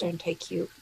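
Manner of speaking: ordinary delivery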